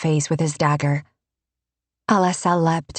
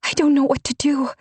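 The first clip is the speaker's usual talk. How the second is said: panicked; 9 semitones higher, faster than usual. A narrator's reading. Alessa's silent panicked prayer.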